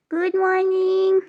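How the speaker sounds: high, slow, drawn-out coo to the cat. Real, not acted. Greeting the cat who just woke. (जागी बिल्ली को ऊँची, धीमी, लंबी आवाज़ में गुड मॉर्निंग)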